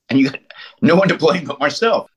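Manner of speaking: ordinary talk, nothing marked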